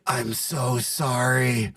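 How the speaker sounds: soft, slow, drawn-out apology; mock-whispered apology, very slow; retold whisper. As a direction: imitate a hushed confession in someone's ear. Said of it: "I whisper in her ear".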